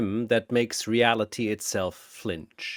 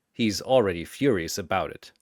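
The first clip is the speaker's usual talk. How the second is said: ordinary delivery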